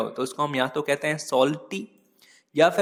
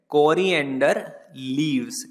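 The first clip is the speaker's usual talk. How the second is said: Coriander leaves said slowly, stress on coriander, falling at the end; slow clear answer; much slower than usual. Shown as a demonstration. Teacher answers her own quiz question for students, slow and clear. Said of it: "क्या बोलते हैं?".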